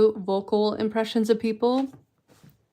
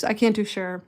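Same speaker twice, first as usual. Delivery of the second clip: ordinary delivery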